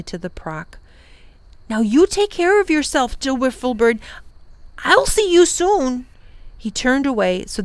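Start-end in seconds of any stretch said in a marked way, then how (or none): 1.7-6.1 put-on higher boy's voice, holding back tears; a boy hiding his distress in goodbye; Tom's farewell. Tom says goodbye to the wiffle bird, holding back sadness.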